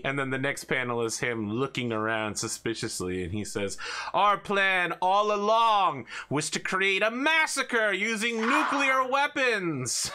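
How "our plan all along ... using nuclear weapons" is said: much higher, slow, scheming cartoon villain; hammy villain confession. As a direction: gloat it out slowly. Villain reveals his plan.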